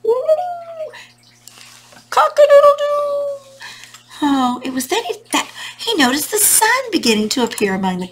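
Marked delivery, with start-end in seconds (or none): 0.0-3.4 very high, hooting rooster crow; high-pitched cock-a-doodle-doo. Acted. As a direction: crow like a storybook rooster, rising to a loud finish. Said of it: "lifted his muddy beak up and crowed".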